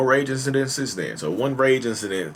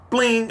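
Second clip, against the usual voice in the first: high-pitched "bling" of breaking glass; ping it high like a bulb shattering; imitated breaking sound. Recounting the studio light breaking, imitated noise.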